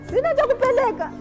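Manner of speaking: ordinary talk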